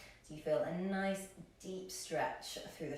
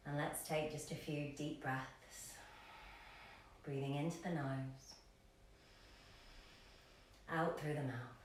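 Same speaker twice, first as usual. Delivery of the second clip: soft, lower voice with long pauses; guided meditation; 3 semitones lower, 6 dB quieter than usual. Acted. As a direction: ease them gently into stillness, leaving space between phrases.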